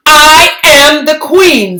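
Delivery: high, loud queenly decree; royal proclamation. Acted. Lily proclaiming herself queen to her parents, loud and grand. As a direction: declare it from the throne.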